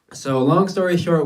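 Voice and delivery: ordinary talk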